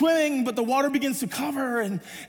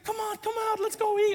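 imitated girl; 11 semitones higher than usual. Girl calling the boy out of the water, imitated girlish voice. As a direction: lightly coax in a pitched-up girlish voice. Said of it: "She says".

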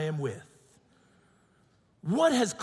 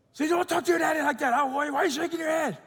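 put-on high, fast Filipino-accented scolding; wife's Filipino scolding; 8 semitones higher, faster than usual. Wife tells daughter off, fast high Filipino scolding. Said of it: "the Filipino comes out".

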